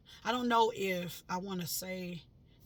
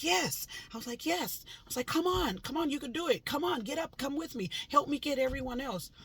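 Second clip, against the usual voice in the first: high, loud, thrilled cheering; excited; 7 semitones higher, 4 dB louder than usual. Retold or imitated. Urging the waking lady to get up, excitedly. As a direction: cheer her on like a coach. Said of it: "I was getting so excited".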